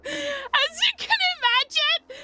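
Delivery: squeezed out through high laughter; laughing. Real, not acted. Storyteller cracking up over own nervous habit, laughing. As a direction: let a giggle lift the words right up.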